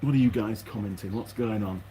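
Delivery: normal talking voice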